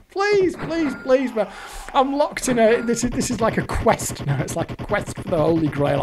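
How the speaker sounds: ordinary talk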